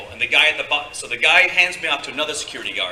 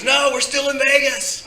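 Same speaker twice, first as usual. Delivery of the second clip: high, slow drawn-out imitation; exuberant friend; 12 semitones higher, 4 dB louder, slower than usual. Club friend correcting the actor, exuberant drawn-out high voice. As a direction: stretch it out high and eager.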